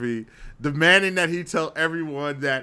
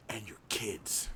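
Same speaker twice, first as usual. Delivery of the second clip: whispered jab; 9 semitones lower, 17 dB quieter, slower than usual. Real, not acted. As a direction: drop to a whisper for the sting. Dramatic jab, trailing off.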